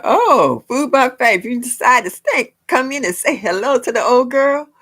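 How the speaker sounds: high, loud welcome to viewers; lively live-stream welcome; excited greeting. Real, not acted. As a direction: call out a big hello to new arrivals.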